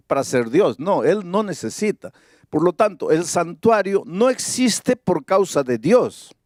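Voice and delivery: normal talking voice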